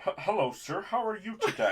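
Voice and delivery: put-on British guy faking an American accent; stiff, badly faked American, amused. Friend mimics British actor's failed American accent, mock-awkward. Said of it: "British guy trying to do a, an American accent".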